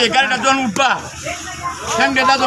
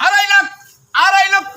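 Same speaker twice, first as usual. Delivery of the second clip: auctioneer; 5 semitones higher than usual. Acted. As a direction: call out the bids like an auctioneer. Auctioneer repeats bid.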